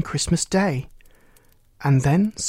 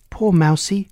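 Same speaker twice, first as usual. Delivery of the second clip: ordinary delivery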